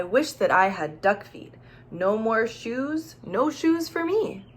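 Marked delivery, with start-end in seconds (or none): none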